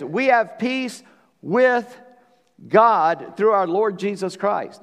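slow, high phrase, pausing right after a weighty "with"; "with" stretched out and left hanging; stress on "with". Real, not acted. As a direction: lean hard on "with" and draw it out. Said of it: "I'm emphasizing that word".

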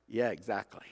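normal talking voice

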